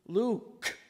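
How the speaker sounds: "Luke" articulated slowly and precisely; carefully pronounced Luke. Shown as a demonstration. Pastor teaching the Luke motion, careful precise "Luke". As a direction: stretch out "Luke" with careful pronunciation. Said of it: "we really emphasize the pronunciation".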